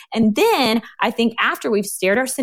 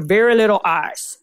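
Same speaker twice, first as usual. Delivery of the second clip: booming Southern voice. Retold or imitated. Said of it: "in his booming Southern voice".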